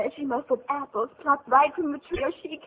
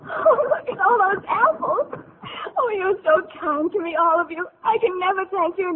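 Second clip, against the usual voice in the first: tearful joy; 8 semitones higher, 8 dB louder than usual. Acted. Marushka overjoyed, tearful.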